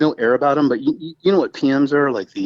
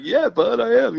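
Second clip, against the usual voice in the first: higher, easy mock reply; easygoing [name]; 11 semitones higher than usual. [name] answering the curator, lightly voiced easygoing yes. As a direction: toss off a relaxed yes.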